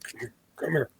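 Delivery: imitating a panicked friend, high and very soft; urgent hushed beckoning, retold; panicky hushed call. Panicking friend beckons from behind, hushed panicky call. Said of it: "he's like, panicking".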